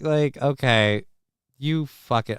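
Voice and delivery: normal talking voice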